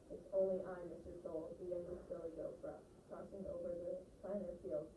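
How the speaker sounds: ordinary talk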